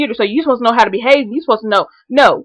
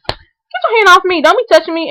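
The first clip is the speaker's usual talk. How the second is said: mock snap; 8 semitones higher than usual. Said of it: "be like".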